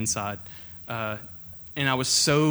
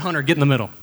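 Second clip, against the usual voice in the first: louder summons across a crowd; calling out; 3 semitones higher, 8 dB louder, much faster than usual. Retold or imitated. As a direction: call his name out to the group. Leaders calling him to the middle.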